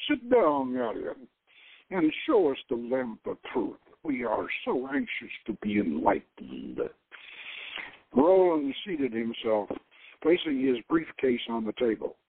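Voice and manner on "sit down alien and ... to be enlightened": lower, mocking Dracula-style accent; a lightly voiced vampire accent, sneering; mock-polite Eastern European accent, dropping low. A narrator's reading. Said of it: "his Dracula accent".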